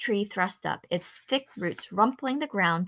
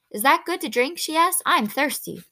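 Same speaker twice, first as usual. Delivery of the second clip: put-on higher, eager young girl; 8 semitones higher, 6 dB louder than usual. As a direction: ask brightly like a curious child. Winnie asks, voiced higher.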